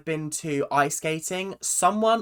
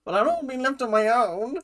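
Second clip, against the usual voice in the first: higher-pitched mock plea; retold timid protest; 7 semitones higher, faster than usual. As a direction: plead like the one left behind, lightly acted. Voices own plea to skating friends.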